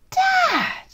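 high shouted Dad of protest; outraged child. Acted. Son to dad, shocked and loud. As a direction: cry out in protest.

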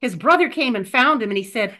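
ordinary talk

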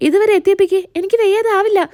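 mildly hammed-up moan; exasperated complaint. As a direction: grumble as if worn out from lifting. Worn-out husband grumbles to wife.

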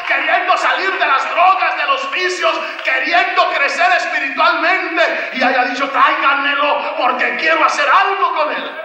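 preaching at full force. Acted. Preacher urging the retreat congregation: fiery full-force preaching. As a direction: preach it out with fire.

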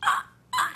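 very high breathy choked ah ah; high broken gasps; imitated sob. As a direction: act out a fan too overwhelmed to speak. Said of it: "And she's like".

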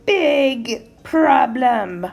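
very slow, loud roar; troll's roar. A narrator's reading. Troll refusing the little goat passage, slow roar. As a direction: stretch each word into a roar. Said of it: "roared the troll".

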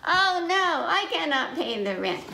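high, loud worried cry; dismayed goat. Acted. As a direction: fret about the rent. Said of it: "goat said".

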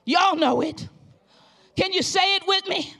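loud, rising revival preaching; preaching at full force. Acted. Pastor exhorting the congregation, loud full-force preaching.

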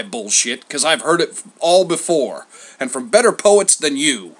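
loud, exasperated challenge; grumpy old poet Homer. A narrator's reading. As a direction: argue back, fed up with excuses.

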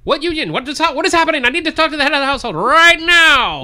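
very high, loud, strained caricature voice; lost newsreel voice. Acted. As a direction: overshoot the newsreel voice wildly.

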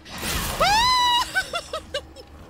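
long, very high shriek; panicked high scream. Real, not acted. Player attacked in hallway, panicked scream. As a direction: scream out in fright.

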